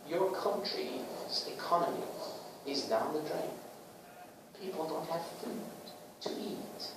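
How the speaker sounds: soft, lightly acted tearful plea; understated mock distress from a politician. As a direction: plead quietly like a politician putting on tears. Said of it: "And he's crying".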